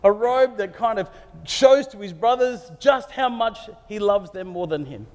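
ordinary delivery

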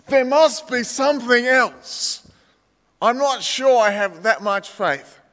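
hesitate, unsure of your faith; doubting believer. Retold or imitated. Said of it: "and you're going".

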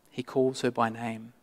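ordinary talk, nothing marked

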